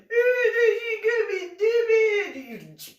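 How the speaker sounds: high, loud, very slow yell; mock shout. Teller yelling about Anna, high, loud and drawn out. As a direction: drag out the insult at full volume.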